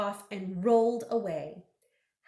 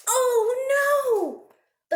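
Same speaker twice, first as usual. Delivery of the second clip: high, loud oh no; mock dismay; 11 semitones higher, 6 dB louder than usual. A narrator's reading. Reader acting dismay at the lost button. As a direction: gasp it out like a shocked kid.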